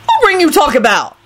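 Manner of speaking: high, wary questioning; suspicious. A narrator's reading. Lucretia pressing Rosie about the ring, suspiciously. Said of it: "Lucretia said suspiciously".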